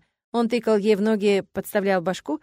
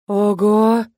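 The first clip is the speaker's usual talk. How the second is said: louder gasp of amazement; stunned exclamation; 8 dB louder than usual. A narrator's reading. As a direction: breathe it out in shock. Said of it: "потрясённо выдохнули подруги". (потрясённое «Ого!»)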